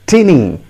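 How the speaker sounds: "tinny" with a short i; wrong pronunciation. Shown as a demonstration. Teacher warning learners off the mistake, "tinny" as TIN-ee. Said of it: "Don't ever pronounce it as".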